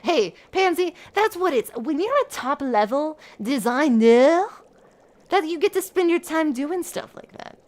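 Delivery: high, snooty fashion voice; haughty designer. Acted. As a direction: lord it over the assistant. Speaks in character as Minette.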